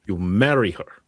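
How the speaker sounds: loud order with falling end; stern command. Acted. Innkeeper repeating her advice to the young man, stern.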